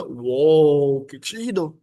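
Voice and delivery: ordinary talk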